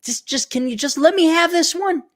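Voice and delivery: lightly voiced nag then whiny plea; mock the scolding; imitating nagging. Streamer mimicking being told off, then begging.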